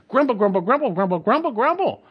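chanted mock grumble, pitched high; mock grumbling. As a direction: mimic whiny churchgoers grumbling. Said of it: "they're grumbling about everything".

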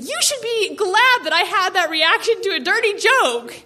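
put-on high, indignant teen plea; pleading her case. Daughter pleading her case over dinner, indignant. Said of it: "I tried to plead my case".

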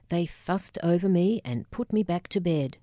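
usual speaking voice

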